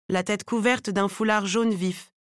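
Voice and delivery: ordinary delivery, nothing marked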